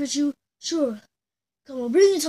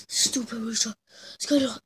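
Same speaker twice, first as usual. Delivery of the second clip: normal talking voice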